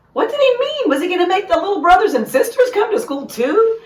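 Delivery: put-on fretting like a nervous child; high worried voice. A narrator's reading.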